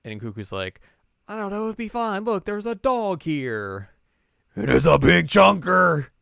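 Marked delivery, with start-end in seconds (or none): 1.3-6.1 cheerful character voice. Retold or imitated. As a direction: coo over the big dog.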